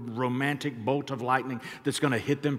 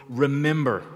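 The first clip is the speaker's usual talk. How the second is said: Remember said louder, falling; emphasis on Remember; 7 dB louder than usual. Shown as a demonstration. Pastor stressing the word Remember for the church. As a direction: hit the word Remember hard. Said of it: "put some type of emphasis on the word".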